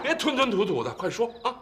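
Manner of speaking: high-pitched, exasperated prodding; impatient urging. Acted. Pressing the stalling man to explain, high impatient demand. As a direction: push him sharply to spit it out.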